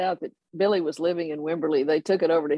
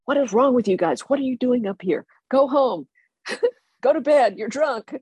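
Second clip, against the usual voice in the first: higher, exasperated mock scolding; exasperated colonel; 7 semitones higher than usual. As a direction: send the drunk boys home like a fed-up officer. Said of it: "Colonel Poole is like".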